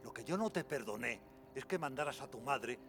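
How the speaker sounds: loud furious accusation; angry shouting. Acted. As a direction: hurl it at him in rage. Furious accusation of his brother.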